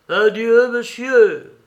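high, loud ringing goodbye; loud farewell. A narrator's reading. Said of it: "dit le tzigane à voix haute".

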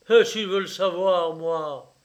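angry challenge. A narrator's reading. Father confronting his secretive son, angry challenge. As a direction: throw down a forceful challenge.

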